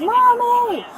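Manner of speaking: put-on high, loud child's wail for mom; imitated crying child. Mother quoting her son in the car, crying call. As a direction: cry out high, imitating an upset little boy. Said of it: "My son start crying".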